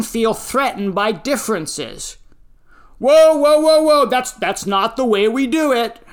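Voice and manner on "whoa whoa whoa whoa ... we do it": much higher, loud startled whoa; alarmed protest. Pretend. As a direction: stop them in alarm. Voicing someone alarmed by differences.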